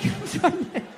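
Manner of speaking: usual speaking voice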